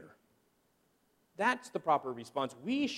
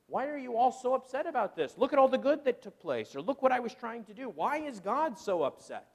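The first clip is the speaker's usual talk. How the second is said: higher, quick, exasperated complaining; mock indignant whine; 6 semitones higher, faster than usual. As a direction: whine like a churchgoer caught out. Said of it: "get angry".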